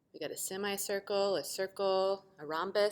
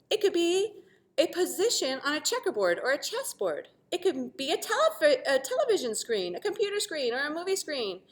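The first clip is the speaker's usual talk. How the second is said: put-on bright, excited read-aloud for children; storytelling voice; 8 semitones higher, 10 dB louder than usual. A narrator's reading. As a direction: narrate with delight. Story narration.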